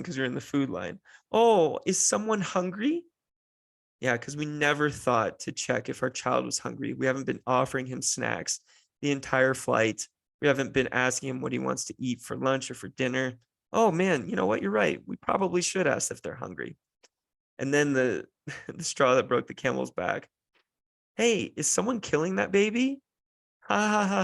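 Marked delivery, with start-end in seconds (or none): 1.3-3.0 high, slow, patronizing question; mock cooing. Stranger asks parents about crying child, high mock-cooing. Mocking stranger in food line. 13.7-16.8 sarcastic, high-pitched fake agreement; mock realization. Dad answers meddling stranger, high sarcastic agreement. 21.2-23.0 high-pitched, mock-jovial question; joking stranger. Stranger jokes about screaming child, high mock-jovial voice.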